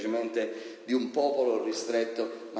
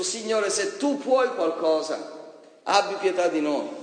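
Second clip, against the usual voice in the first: doubtful pleading father; 7 semitones higher, 3 dB louder than usual. Retold or imitated. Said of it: "E poi disse a Gesù".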